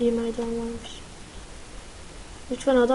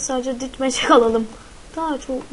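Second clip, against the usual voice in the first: ordinary delivery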